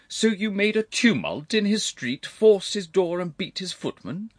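high, loud outraged gentleman; indignant uncle. Acted. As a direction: demand an account, high and forceful.

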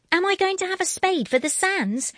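put-on high, loud little-girl question; hopeful child. A narrator's reading. Girl asking mother for beach spade, high hopeful.

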